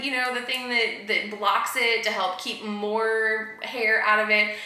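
usual speaking voice

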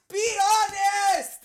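high, slow put-on voice; silly voice. Podcaster repeating his catchphrase, goofy. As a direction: stretch it out in a goofy voice. Drawn-out joke voice.

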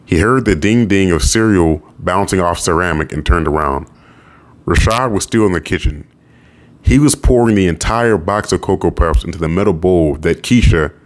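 plain reading voice

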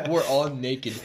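said through laughter; laughing. Real, not acted. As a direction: still laughing. Laughing at a dog joke.

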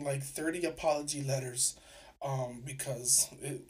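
ordinary delivery

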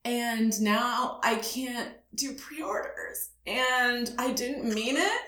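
high-pitched, helpless innocent pleading; playing dumb, near tears. Caller begging Amazon to lift a ban, mock-tearful. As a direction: act new and clueless, about to cry. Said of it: "Did you act like you were gonna cry?".

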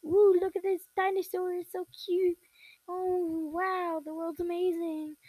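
high-pitched mock wonder; sweet, awestruck falsetto impression; cutesy, wide-eyed personality. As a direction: gush like an amazed little kid, up high. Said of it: "his personality is like".

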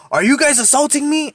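high-pitched accusing cry; mock drunk lady ranting; ranting accusation. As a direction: accuse them in a shrill rising rant. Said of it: "she just starts going off on a rant".

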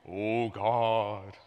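mock pious prayer voice, changed timbre; holy prayer voice. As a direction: pray in an exaggerated churchy tone. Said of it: "they have their holy voice".

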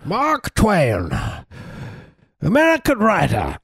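high-pitched, drawn-out impersonation; hammed-up writer announcing himself in a high swoop; Mark Twain. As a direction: put on a high, theatrical old-time American writer. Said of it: "I do Mark Twain impersonation".

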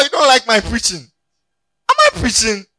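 high, loud preaching to the congregation; preacher scolding a sleepy congregation, loud and high; full-force preaching. Acted. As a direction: preach it out hard.

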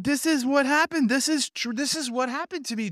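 very high, breathless-with-amazement outcry; astonished discovery. Retold or imitated. New reader to his host over the Bible, astonished. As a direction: imitate an overjoyed man who has found himself in the text.

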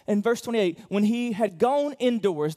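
high, loud impassioned preaching; fiery preaching. Real, not acted. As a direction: drive it home to the congregation. Preaching at full force.

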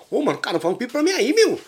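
lightly voiced kid begging; a hint of a boy asking for a kite. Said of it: "pro fulano que veio aqui pedindo". (moleque pedindo pipa, voz leve de garoto)

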